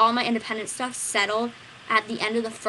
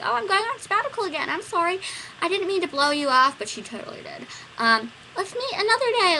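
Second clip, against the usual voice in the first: higher, breezy apology; airy teacher voice; 8 semitones higher, 3 dB louder than usual. Retold or imitated. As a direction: brush it off lightly, higher. Quoting the teacher.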